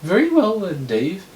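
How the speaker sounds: high put-on judge voice; lilting falsetto judge; Rachel Lloyd. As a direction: preside in a high, theatrical voice. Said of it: "Rachel Lloyd".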